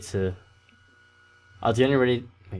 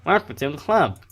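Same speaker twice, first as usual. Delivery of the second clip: higher, louder nerdy voice; nerd voice; 6 semitones higher, 5 dB louder than usual. Acted. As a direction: play him as a nerd. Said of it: "He sounds like a nerd".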